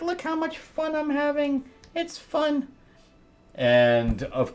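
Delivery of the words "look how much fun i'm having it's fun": high, softer falsetto gloating; mock gleeful villainess. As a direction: tease in a small high voice.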